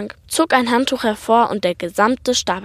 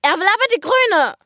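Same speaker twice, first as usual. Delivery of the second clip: hoch und laut, genervt im Gleichklang; leicht gespielt im Chor; 11 semitones higher, 4 dB louder than usual. Acted. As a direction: halb genervt, halb belustigt zusammen ausrufen. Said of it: "sagten die Mia und der Papa im Chor". (Schwester und Papa rufen der Mama im Chor zu)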